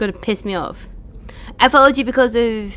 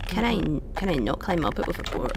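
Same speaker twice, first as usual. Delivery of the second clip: ordinary delivery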